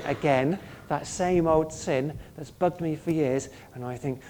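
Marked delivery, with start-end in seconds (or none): none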